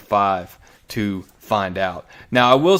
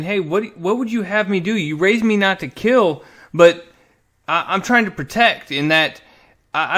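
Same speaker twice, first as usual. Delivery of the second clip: higher-pitched, pleading mock protest; retold challenge; 6 semitones higher, 3 dB louder than usual. Thanos challenges Frank over being raised not to kill, mock challenge. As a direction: argue back as the challenged son, pitch raised. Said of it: "Thanos challenges it".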